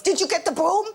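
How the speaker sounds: loud, high imitation of mum; insistent mother. Mother days later still on about the broom, mock insistence. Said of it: "she goes".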